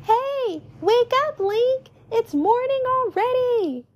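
put-on high-pitched child's wake-up shout; mock scream in a high kid voice; calling out. A narrator's reading. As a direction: holler high to rouse a sleeping friend. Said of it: "screaming out to him".

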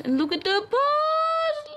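very high, slow squealing coo. Real, not acted. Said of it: "I also talk in a baby voice like this".

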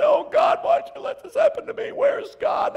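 high, loud, shouted whining at God; hammed-up whiny shout to God; whining complaint. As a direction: wail like a spoiled complainer. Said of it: "you whine and you say".

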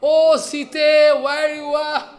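loud, slow, high wailing cry for Sita; mock crying call. Ramchandra searching for Sita, crying out loud and slow. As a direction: call out for the lost wife in hammed-up grief. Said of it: "why he can cry".

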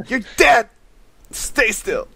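high, loud mock threat; yelling sniper. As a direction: holler it like a hammed-up game enemy. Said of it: "Is just to yell".

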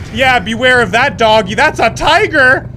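loud alarmed cry; alarmed surprise. Real, not acted. Player shocked at the tiger, loud alarm.

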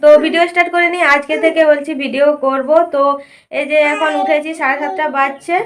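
bright, loud and lively greeting; upbeat vlog intro. Acted. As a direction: pump up the viewers.

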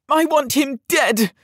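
put-on high, boyish howl of rage; howled demand. A narrator's reading. Boy lord ordering guards to kill, high howl. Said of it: "Yared howled".

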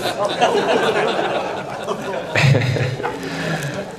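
breathy unvoiced laugh. Real, not acted. Speaker laughs at his grandfather's advice, airy laugh. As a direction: laugh silently at the memory.

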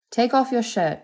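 high, insistent order; demanding. A narrator's reading. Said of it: "she demanded".

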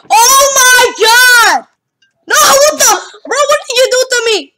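high, loud, wailing protest; outraged yell. Real, not acted. Gamer to teammate who wronged them, outraged yell. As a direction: yell in disbelief at what was done to you. Upset at friend in game.

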